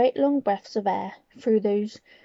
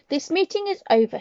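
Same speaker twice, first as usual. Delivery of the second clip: shouted; 7 semitones higher, 3 dB louder than usual. Acted. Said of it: "she shouted".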